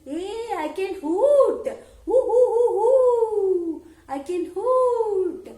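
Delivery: high, loud owl voice with drawn-out hoots; hooting owl. Acted. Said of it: "the owl said".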